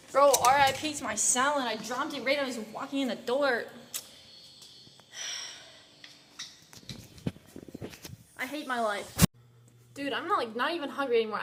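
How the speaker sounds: real annoyance over a dropped salad; frustrated rant. Real, not acted. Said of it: "sorry about that rant".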